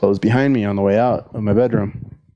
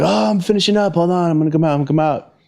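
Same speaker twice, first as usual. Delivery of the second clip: much higher-pitched imitation of a stalling friend; flustered stalling; 8 semitones higher than usual. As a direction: call out hurriedly that you're nearly done, pitched way up.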